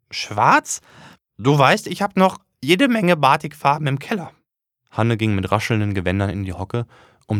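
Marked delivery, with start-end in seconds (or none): 0.1-4.3 high, louder eager mother; high eager mother's voice; excited mother. Acted. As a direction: gush about the dyes. Mother enthuses about dyes.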